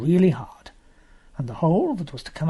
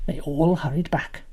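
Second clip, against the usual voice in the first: plain reading voice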